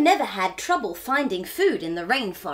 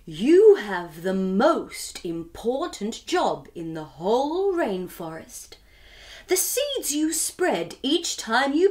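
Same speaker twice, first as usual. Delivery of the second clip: wisest owl voice; 3 semitones lower than usual. Acted. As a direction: deliver it like an ancient wise owl. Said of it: "said the owl in her wisest voice".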